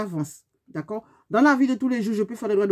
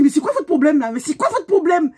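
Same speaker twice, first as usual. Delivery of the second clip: louder, higher, furious demand; angry confrontation; 6 semitones higher, 6 dB louder than usual. Retold or imitated. Yelling at the other driver in the street, furious. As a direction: confront her hard. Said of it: "j'étais énervée".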